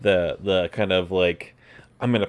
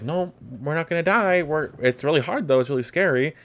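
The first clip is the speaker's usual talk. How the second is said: higher, sing-song reassuring voice, imitating herself; mock soothing reassurance; 8 semitones higher than usual. To her panicking partner, a higher, gently reassuring voice. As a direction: soothe someone who thinks they're dying.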